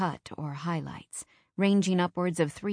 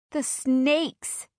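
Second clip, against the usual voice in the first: sobbing; 8 semitones higher, 3 dB louder than usual. Acted. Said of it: "she sobs".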